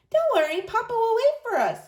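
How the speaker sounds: put-on a child reassuring her worried mother; reassuring girl. A narrator's reading. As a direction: comfort Mama like a brave little girl. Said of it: "Clara assured her".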